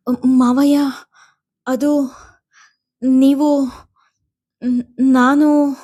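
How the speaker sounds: high, broken, stammering start-stops; halting stammer. A narrator's reading. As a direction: stumble over each word. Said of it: "ತಡೆ ತಡೆದು ಹೇಳುತ್ತಾ".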